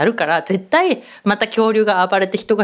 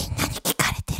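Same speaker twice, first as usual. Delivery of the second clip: whispered in alarm; 9 dB quieter, faster than usual. Retold or imitated. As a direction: hiss it under your breath, caught out. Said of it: "とか言って". (小声でささやく「まずい」)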